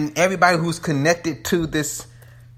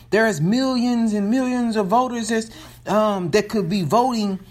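high put-on spokeswoman voice, pitched up; spokesperson voice; 7 semitones higher than usual. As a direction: sell the idea like a corporate spokeswoman. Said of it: "she was talking about".

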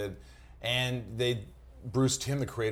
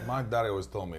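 usual speaking voice

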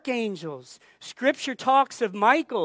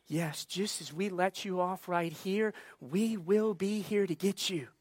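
put-on soft, lower reassurance to a child; reassuring parent; 4 semitones lower, 10 dB quieter than usual. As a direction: gently promise a child, voice lowered. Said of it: "like the parents dropping off the child".

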